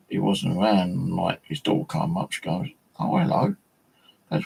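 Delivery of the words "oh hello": high-pitched mock surprised hello; surprised greeting. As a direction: greet someone unexpected, pitched well up. Neighbour's daughter greets him, retold.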